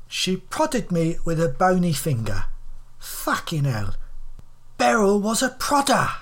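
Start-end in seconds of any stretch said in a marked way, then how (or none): none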